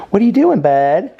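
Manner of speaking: high and loud to the pup; excited call. Real, not acted. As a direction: call out to the puppy. Calling to puppy.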